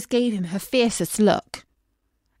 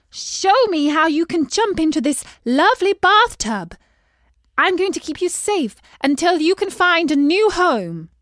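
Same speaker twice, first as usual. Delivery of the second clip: higher, louder coaxing call to the pond; 5 semitones higher, 5 dB louder than usual. A narrator's reading. Princess calling the fish to the bathtub. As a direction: call sweetly across the water. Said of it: "she called out".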